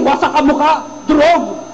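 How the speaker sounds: normal talking voice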